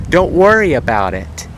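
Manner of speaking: sharp, loud shouted retort; yelling. Retold or imitated. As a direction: yell it back across the lot. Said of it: "he yells back at me and says".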